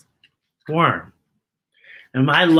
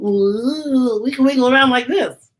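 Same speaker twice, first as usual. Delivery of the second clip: a touch higher, playful worm; lightly voiced worm; 5 semitones higher than usual. Worm showing off its wiggle, lightly higher. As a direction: wiggle through the words lightly. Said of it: "Your worm wiggle?".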